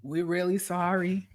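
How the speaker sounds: hammed-up corporate sympathy; mock apologetic boss. Mocking the boss apologizing for the layoff, soft and fake-sorry. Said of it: "these bitches tell me".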